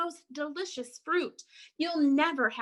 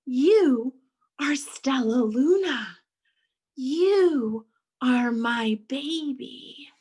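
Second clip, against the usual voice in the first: slow, breathy, drawn-out recognition; emotional whisper; 3 semitones higher, 6 dB louder, slower than usual. Acted. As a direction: whisper it slowly with a mother's wonder. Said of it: "she whispered".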